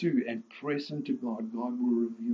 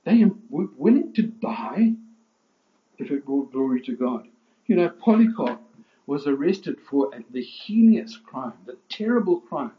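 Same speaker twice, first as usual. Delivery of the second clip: preaching at full force; 2 semitones higher, 7 dB louder than usual. Acted. Preacher to his congregation, at full force.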